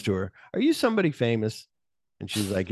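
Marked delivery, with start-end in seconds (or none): 0.5-1.7 quoted curious question. Retold or imitated. Voicing stuntman's question to her.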